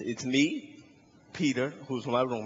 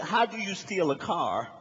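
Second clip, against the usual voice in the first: ordinary talk, nothing marked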